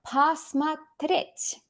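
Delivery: very slow, each syllable drawn out: Посмотреть; slow demo word. Shown as a demonstration. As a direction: say Посмотреть slowly and clearly like a teacher. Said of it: "here's another one".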